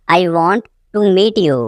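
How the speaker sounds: teacher splitting it after "want"; broken-up repeat. Shown as a demonstration.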